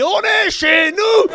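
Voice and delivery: very high, slow shouted chant; hammed-up rally chant; mock rally shout. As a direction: bellow it like a nationalist rally. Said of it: "j'ai crié".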